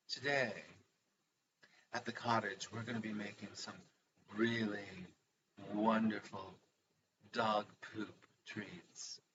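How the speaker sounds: hushed, sweetly mock-elegant cooking-show host; Ina Garten impression. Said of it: "Isn't that how she speaks?".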